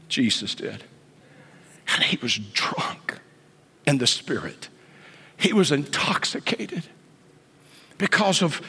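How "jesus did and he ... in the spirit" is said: low, soft, near whisper; hushed answer. Real, not acted. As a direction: drop right down after the build. Preacher falls to hush.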